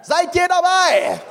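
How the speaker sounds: high shouted question; rallying call. Real, not acted. Preacher asking the church to join, shouted high. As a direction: call it out high to fire them up.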